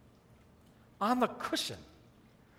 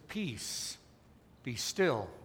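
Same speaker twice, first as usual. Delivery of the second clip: very soft, low, spaced-out words; calm command; 8 semitones lower, 11 dB quieter, much slower than usual. Acted. Jesus rising to still the sea, quiet and calm. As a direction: hush the storm quietly. Jesus calming the storm, acted.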